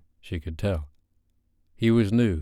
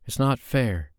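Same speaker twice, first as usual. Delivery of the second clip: usual speaking voice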